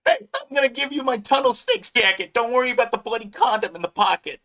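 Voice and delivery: high put-on gang-kid voice; gang member. Gang member handing over his jacket, high put-on voice.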